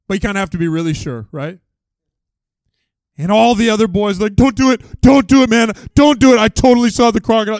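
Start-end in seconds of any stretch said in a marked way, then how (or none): 4.3-7.6 high, loud, frantic pleading; mock urgent warning. Boys begging him not to jump in, loud mock panic.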